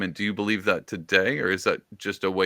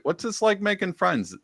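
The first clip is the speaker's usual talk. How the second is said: mock naive question; 13 semitones higher than usual.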